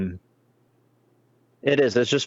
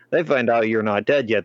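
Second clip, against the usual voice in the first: ordinary delivery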